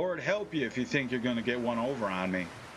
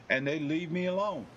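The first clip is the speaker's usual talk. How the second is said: normal talking voice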